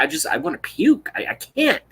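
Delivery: normal talking voice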